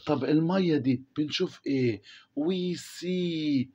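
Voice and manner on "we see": a pupil's wrong answer, lightly voiced; wrong tense, voiced as a pupil. A pupil gives the teacher the wrong tense, lightly voiced. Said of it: "لا يا مستر، ما ينفعش تقول we see".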